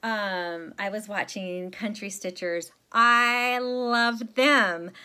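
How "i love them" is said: loud, drawn-out, high-pitched gush; emphatic, stretched-out enthusiasm; gushing adoration. Real, not acted. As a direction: really belt out your love, slowly. Gushing about favourite stitching vloggers.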